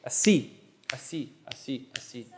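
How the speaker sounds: A C said as ah-SEE, pushed on the end; A C with the weight on C; stress placement. Shown as a demonstration. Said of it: "where you're putting your".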